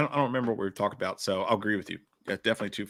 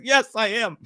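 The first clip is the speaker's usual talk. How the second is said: high-pitched mock submission; hammed-up defeated squeal; meek surrender; 9 semitones higher, 5 dB louder than usual. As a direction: give in with a comic high yelp. Retold meek surrender to her.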